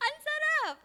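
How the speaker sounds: high savoring exclaim; delighted father. Retold or imitated. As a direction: relish the taste. Repeated savoring. (tuwang-tuwa)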